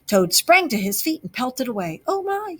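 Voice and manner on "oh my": much higher panicked gasp; breathless gasp. A narrator's reading. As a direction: gasp it out while running. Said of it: "he gasped as he ran".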